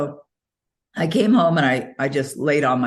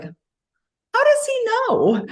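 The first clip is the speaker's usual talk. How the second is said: amazed; much slower than usual. Retold or imitated. Seeing Bob calling right then.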